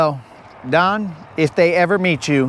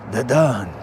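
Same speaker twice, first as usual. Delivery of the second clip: Italian mobster; 5 semitones lower than usual. Acted. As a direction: play a mock mob don. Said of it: "an Italian mobster".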